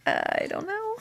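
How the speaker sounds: high, breezy "I don't know" tossed off with a laugh; clueless shrug. Retold or imitated. Groomer answering the owner's question, high and clueless. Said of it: "And I'm like".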